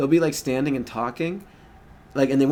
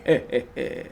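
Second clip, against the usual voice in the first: vampire impression. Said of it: "is that your impression of a vampire".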